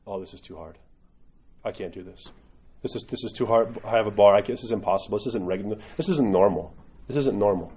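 mock whiny overwhelmed convert, low and soft; whiny newcomer. Priest imitating a discouraged convert, softly whining. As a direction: imitate a newcomer giving up, half-mocking. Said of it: "and they go".

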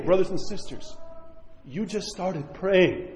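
usual speaking voice

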